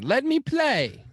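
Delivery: very high, loud, slow mimicry; hammed-up high-pitched quote; mock goalie impression. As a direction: drag it out as a comic high plea. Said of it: "Remember that, dude?".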